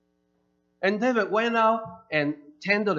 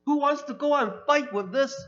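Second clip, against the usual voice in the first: higher, louder scolding brother voice; big brother telling off a reckless younger boy, higher and louder; upset older brother; 9 semitones higher, 4 dB louder than usual. Retold or imitated. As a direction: scold the little brother from above, pitched high. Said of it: "That's why he was quite upset".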